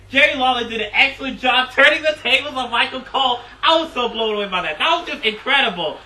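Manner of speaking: loud, high-pitched fan enthusiasm; real excitement. Real, not acted. Wrestling fan raving about Jerry Lawler turning the tables, excited. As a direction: gush loudly, blown away by it. Fan thrilled recapping the upset.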